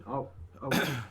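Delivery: usual speaking voice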